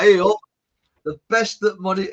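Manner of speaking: hammed-up Barnsley bloke voice; bad northern accent. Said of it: "must sound terrible, a northern accent".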